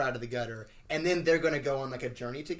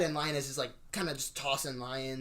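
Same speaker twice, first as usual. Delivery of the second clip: normal talking voice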